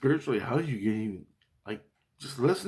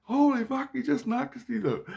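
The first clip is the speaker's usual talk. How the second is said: very high, squealed mock scream; imitated scream; 13 semitones higher than usual. Teller imitates a Christ screaming about a punch, high mock scream. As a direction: squeal it in shock, drawing out the first words. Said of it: "one of my Christs scream out".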